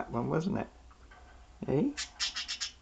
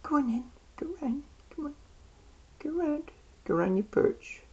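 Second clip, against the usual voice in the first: high coaxing pet talk. Real, not acted.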